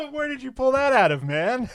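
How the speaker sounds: high, loud burst of real laughter; incredulous, laughing hard at a high pitch; laughing disbelief. Real, not acted. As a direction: crack up, voice shooting way up as you ask. Cracking up at obscure title.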